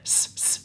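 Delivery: s made as a bare hiss; short hissed s, not the letter's name; isolated s sound. Shown as a demonstration. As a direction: sound out each s by itself. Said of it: "s sound".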